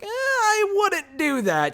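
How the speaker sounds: high, slow put-on voice, hesitant; mock cautious voice. Natalya cautioning Nia Jax, high slow mock voice. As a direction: stretch it out high and wary. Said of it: "And Natalya's like".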